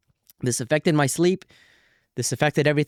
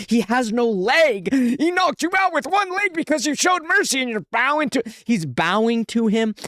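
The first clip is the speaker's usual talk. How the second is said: heated rant; 8 semitones higher than usual. Real, not acted. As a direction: rant like a fan yelling at the screen. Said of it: "Apologies for getting heated".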